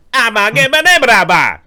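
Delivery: loud, high Cajun gibberish; mock Cajun. Cajun drinker answering a whiskey offer, loud mock-Cajun gabble. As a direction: holler garbled Cajun like a drunk local. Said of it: "this dude talking Cajun".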